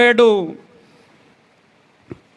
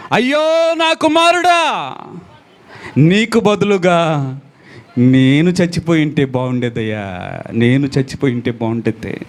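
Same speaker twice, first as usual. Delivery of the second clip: preacher voicing David weeping that he should have died instead; weeping lament; 5 semitones lower than usual. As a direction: wail it, then let it fall away. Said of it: "ఏడుస్తూ అంటున్నాడు".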